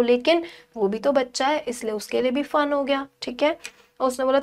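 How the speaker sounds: usual speaking voice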